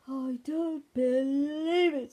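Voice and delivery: loud, slow, high disbelief; exasperated. A narrator's reading. Daddy Bear reacts to Ben's shouting, loudly exasperated. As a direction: stretch it out in exasperation.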